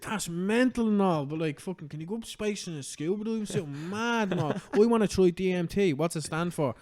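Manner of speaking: a clueless fan wondering aloud about space and DMT; dopey fan voice. Retold or imitated. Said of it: "it's just like".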